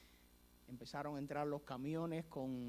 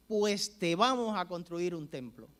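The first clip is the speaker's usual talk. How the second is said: booming, confident declaration; a hint of a commanding leader; loud promise; 3 semitones higher, 10 dB louder than usual. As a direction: announce it grandly, sure of yourself. Fidel promises the church building.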